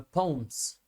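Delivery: पॉम्स said with an au sound, one syllable; पॉम्स as one long au syllable; poems said right. Shown as a demonstration. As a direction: say पॉम्स like pawms, not po-em. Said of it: "सही उच्चारण होता है पौम".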